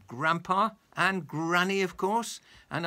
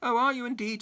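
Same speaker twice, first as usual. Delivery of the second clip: a higher, louder sniffy retort; 10 semitones higher, 6 dB louder than usual. Acted. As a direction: sniff it out, unimpressed and superior. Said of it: "sniffed the person".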